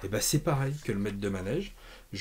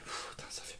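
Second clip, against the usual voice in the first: soufflé tout bas, comblé; chuchoté ravi; 13 dB quieter than usual. Real, not acted. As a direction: confidence murmurée au public. Plaisir devant les planches. (chuchotement ravi d'un collectionneur comblé)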